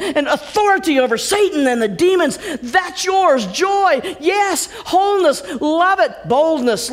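loud, high rousing proclamation; fervent preaching. Real, not acted. Preacher rallying the congregation to claim blessings. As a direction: preach it like a revival. Preacher builds to full force.

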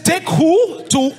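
high, drawn-out outraged challenge; indignant snapping. Retold or imitated. Hostage hearing the plan to kill him, indignant snapping. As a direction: snap back in disbelief, slow and high. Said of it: "So I snapped".

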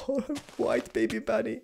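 high-pitched adoring coo; cooing delight. Real, not acted.

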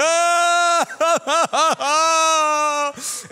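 loud, high mourner's wail; a long, loud, high keening "Oh"; imitated wailing. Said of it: "And he's good".

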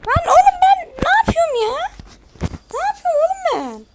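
high, loud frustrated yelling; real gamer rage, voice cracking high; furious outburst. Real, not acted. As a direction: shout at yourself, nerves shot. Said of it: "sinirim bozuldu".

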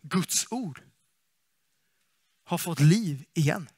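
understated acted amazement; hushed awe. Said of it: "utan de sa".

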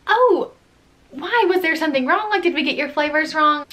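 sugary shop-counter concern; bright surprised question. Retold or imitated. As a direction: chirp it like a cashier caught off guard. Own puzzled customer service reply.

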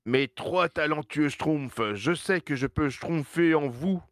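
put-on high, slow old chief voice; Papa Smurf voice. (voix du grand Schtroumpf, lente et aiguë)

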